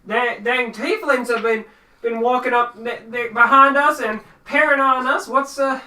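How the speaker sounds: high, loud stammering farmer; shaken farmer. Acted. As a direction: blurt it in a panic, tripping over words. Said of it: "He seems shaken by this".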